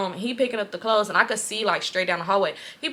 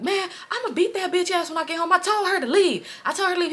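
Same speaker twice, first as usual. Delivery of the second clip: high-pitched indignant complaint; imitated angry brother; 9 semitones higher than usual. Brother on phone about girl who stayed, imitated outrage. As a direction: act his outrage in a raised pitch.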